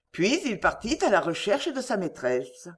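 plain reading voice

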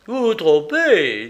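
high, loud, drawn-out objection; contradicting wife. Acted. Narrator voices the contradicting wife.

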